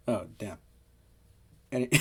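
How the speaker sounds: ordinary talk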